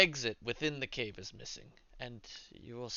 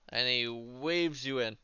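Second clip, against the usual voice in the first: ordinary talk, nothing marked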